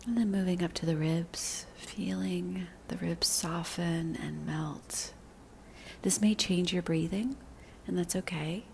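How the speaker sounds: slow, soothing meditation voice with long pauses; unhurried guidance, letting each phrase settle; guided body scan meditation. Real, not acted. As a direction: lead them gently into relaxing, drawing out the phrases.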